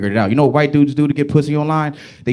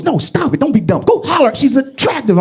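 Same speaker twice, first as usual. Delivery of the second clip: high, insistent grandma; lightly voiced grandma scolding; grandmother voice; 9 semitones higher than usual. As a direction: push him like a bossy grandma. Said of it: "She goes".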